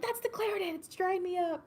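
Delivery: high, surprised self-quote; surprised exclaim. Retold or imitated. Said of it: "I'm like".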